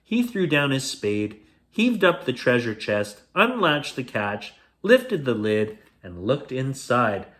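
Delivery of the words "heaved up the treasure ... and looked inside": usual speaking voice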